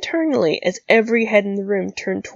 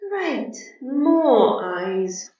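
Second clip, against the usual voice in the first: weary sarcasm; 5 semitones higher, much slower than usual. Acted. Rigel sighs at stares.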